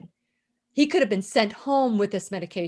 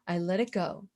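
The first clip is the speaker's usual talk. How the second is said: soft, low, almost whispered; soft release; 5 semitones lower, 7 dB quieter than usual. Real, not acted. Speaker releasing anger from within, soft and low. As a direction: let it out quietly, lower. Repeats letting it go, softening.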